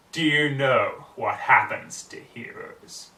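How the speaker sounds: slow, loud, gloating threat; menacing villain. A narrator's reading. Nightmare Moon threatening the trapped Rarity, slow and loud. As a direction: drag out the threat like a cruel queen.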